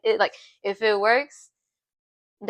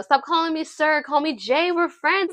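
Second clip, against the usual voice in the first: higher-pitched, eager mock pleading; playful insisting; 6 semitones higher than usual. Jay insisting over ramen that they're friends, playful pleading. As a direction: act out an earnest nudge, climbing higher and speeding up.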